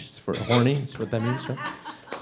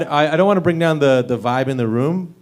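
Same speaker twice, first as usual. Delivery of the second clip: ordinary talk, nothing marked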